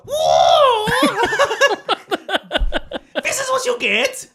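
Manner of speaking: shouted kung fu movie voice, very high and laughing; hammed-up Chinese martial arts accent yelling; mock Chinese accent. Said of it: "why did he put a Chinese accent on".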